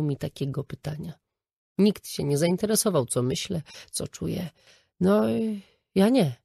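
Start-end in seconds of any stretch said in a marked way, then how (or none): 5.0-6.4 halting, stumbling reply; mother stammering when her son asks about her feelings; stammered. A narrator's reading. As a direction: stumble over the words, caught off guard. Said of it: "wydukałam".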